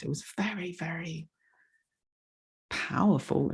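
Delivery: words catching as real tears rise; close to tears. Real, not acted. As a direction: voice breaking into a near whisper. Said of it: "oh my God, I'm gonna cry now".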